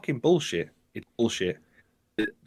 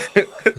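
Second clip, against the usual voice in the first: high, loud laugh bursting out; burst of laughter; 10 semitones higher, 6 dB louder than usual. Real, not acted. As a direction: crack up loudly. Laughing at pay-per-view enthusiasm.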